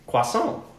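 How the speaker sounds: Frenchified "croissant", lifted like a question; French-style croissant. Shown as a demonstration. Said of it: "I prefer to say".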